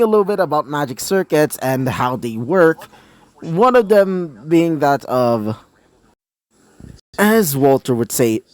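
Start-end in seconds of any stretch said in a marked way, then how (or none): none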